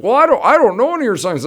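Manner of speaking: high, fast, loud flustered excuse; panicked protest. Retold or imitated. Luke protesting to bandleader, high flustered panic. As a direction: blurt it out like a kid caught red-handed.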